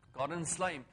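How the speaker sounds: ordinary delivery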